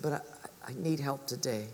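low, hushed and halting; quiet plea. Acted. Haggard friend asking the cobbler for help, subdued plea. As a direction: plead softly, barely pushing the words out.

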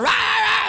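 mock roar. Son yelling.

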